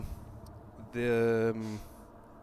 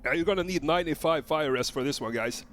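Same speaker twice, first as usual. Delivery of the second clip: ordinary talk, nothing marked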